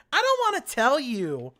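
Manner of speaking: exaggerated whiny tone. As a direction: whine it like a pouting girl. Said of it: "in a very whiny tone".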